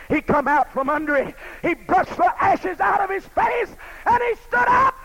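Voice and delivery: loud, high, impassioned preaching; preaching at full force. Acted. Preacher to his congregation, retelling Job, full-force preaching. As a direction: thunder it out to the congregation. Preaching Job's and God's words.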